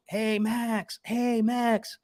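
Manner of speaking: high mock call across a mall; lightly hailing a stranger. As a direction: call his name from far off in a mall. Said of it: "somebody yells".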